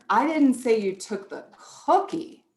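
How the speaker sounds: "didn't" lifted and pushed in "I didn't say you took the cookie"; stress on DIDN'T. Shown as a demonstration. Teacher showing parents how stressing "didn't" changes the meaning. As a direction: lean on "didn't" with a rise. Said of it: "Do you see how inflection just changes the meaning".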